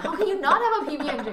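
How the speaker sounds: fast, high, laughing disbelief; laughing quote. Retold or imitated. Laughing friend asks, baffled by no PB&J. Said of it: "he was like".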